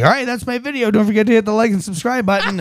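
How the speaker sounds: high mock YouTuber sign-off; YouTuber outro. Dad imitating his son ending a video, high YouTuber voice. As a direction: play a kid aping vloggers.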